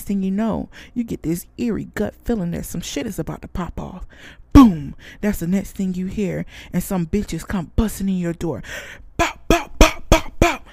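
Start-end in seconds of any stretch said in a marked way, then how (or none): 4.5-4.9 shouted boom. Acted. Telling viewers about the attack, a shouted boom. Imagined door bursting open. 9.2-10.6 high, loud "bow, bow" gunfire ad-libs. Retold or imitated. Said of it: "In my motherfucking [name] voice".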